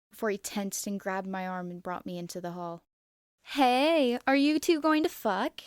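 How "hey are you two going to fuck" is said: loud, high crude yell; yelled across a room. Acted. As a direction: holler it across the dining hall. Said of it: "Miu yelled from the dining hall".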